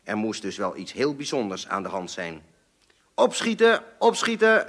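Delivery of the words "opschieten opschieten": veel hoger en luid, opjagend; haastig roepen. A narrator's reading. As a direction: jaag het gezin op vanuit de gang. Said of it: "riep mijn vader op de gang". (opjagend geroepen, veel hoger)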